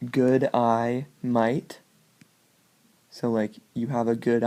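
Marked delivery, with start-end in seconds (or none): 0.1-1.8 three words stretched out slowly; slow and separate. Shown as a demonstration. Teaching the second mnemonic, words said slowly. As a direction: spell it out word by word, slow. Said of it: "if you say".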